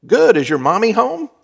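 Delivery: put-on a caller putting on friendliness for a child; upbeat solicitor. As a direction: sound like a pushy salesman brightening up. Solicitor asking child on phone.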